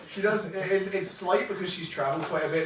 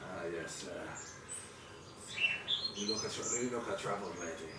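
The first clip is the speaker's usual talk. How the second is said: lightly voiced fisherman, low-key and approving; old fisherman; 8 semitones lower, 10 dB quieter than usual. Fisherman sizes up a travelled lady, low-key and respectful. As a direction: play a humble, hesitant old local.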